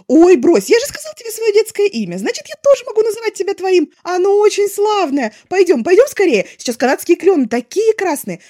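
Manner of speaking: loud, high, bubbling with plans; eager chatterbox. A narrator's reading. Wei Ying pitches fun plans to Lanzhan, loud and eager. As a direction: pour out every idea at once.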